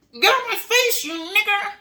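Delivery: very high, strained furious yell; angry bigot shout. Retold or imitated. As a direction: screech it in hateful rage. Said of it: "When a Caucasian is upset".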